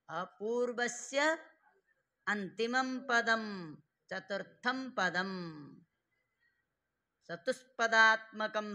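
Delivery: loud, slow word-by-word parsing; slow emphatic dictation. Shown as a demonstration. Teacher parsing a sutra for students, slow and emphatic. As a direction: spell out each word firmly for the class.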